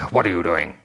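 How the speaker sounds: low growled question. Acted. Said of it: "Mister Miles growls".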